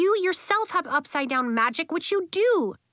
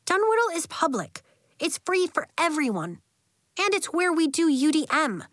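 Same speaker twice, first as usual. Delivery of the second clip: high, insistent schoolkid voice; emphatic, pitched-up persuasion; defiant argument; 7 semitones higher than usual. A narrator's reading. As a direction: argue the case with conviction. Nori argues for her school.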